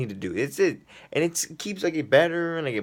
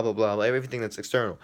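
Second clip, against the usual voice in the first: usual speaking voice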